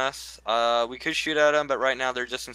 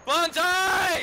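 war cry. Acted. Mock war cry in charge.

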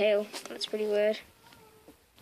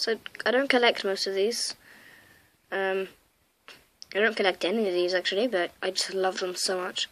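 usual speaking voice

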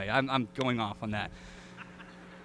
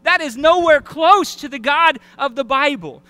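preaching at full force; 5 semitones higher, 6 dB louder than usual. Real, not acted. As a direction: hammer it home from the pulpit. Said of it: "preaching at full force".

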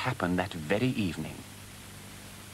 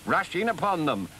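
raised, eager Badger voice; rallying call; 7 semitones higher than usual. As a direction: urge the others on at a higher pitch. Battle plan builds between excited friends.

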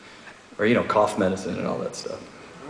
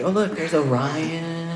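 higher, drawn-out voice; stargazing girlfriend; 5 semitones higher, much slower than usual. Retold or imitated. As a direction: point out stars with slow, lingering words. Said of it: "She was pointing out all the constellations".

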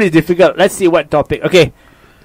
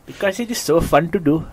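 put-on Indian accent, played for fun; playful demo of an Indian accent; 5 dB quieter than usual. Shown as a demonstration. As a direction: show off a comic Indian accent. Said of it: "Indian accent".

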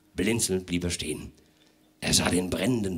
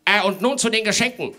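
high, hurried, hemming gentleman; a busy host rushing things along; fussy host; 11 semitones higher, 5 dB louder, faster than usual. Acted. Herr Lemm voiced high and hasty.

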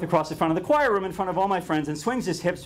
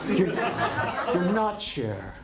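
very slow, laughing, patronizing; drawn out like a patronizing do-gooder; mock condescending; 4 semitones higher, much slower than usual.

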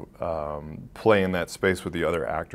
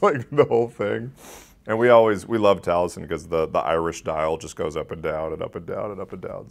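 normal talking voice

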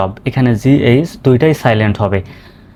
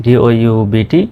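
ordinary talk, nothing marked

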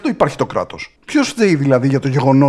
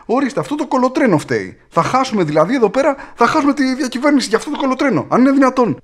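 mock politician; 8 semitones higher than usual. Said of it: "Βγήκε κάποιος άνθρωπος και είπε".

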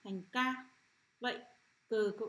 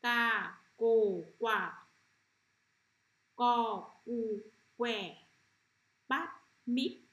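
loud, word-by-word teacher's model reading; loud, separated syllables with pauses between; read-after-me model; 7 dB louder, much slower than usual. Shown as a demonstration. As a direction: call out each syllable loudly for the class to repeat. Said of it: "Bạn đọc lại theo cô nhé".

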